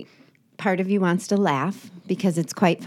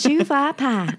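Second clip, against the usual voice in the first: higher, copying the Southern "shoe fly pie"; Southern accent attempt; 6 semitones higher, slower than usual. Copying a friend's Southern phrase in Southern accent. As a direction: try on the Southern twang. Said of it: "I'm trying to learn the South".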